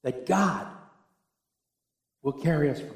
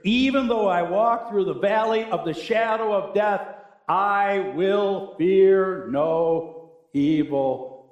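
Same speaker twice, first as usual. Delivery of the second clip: raised, ringing psalm recitation; declaimed scripture; 5 semitones higher, 5 dB louder, slower than usual. Real, not acted. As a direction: proclaim the verse, drawing out the final words.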